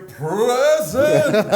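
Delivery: high, drawn-out roll-call answer; mock obnoxious schoolkid answering roll call; mimicked Corvid Crowe voice. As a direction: squawk it out high and long. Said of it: "say his name in that voice".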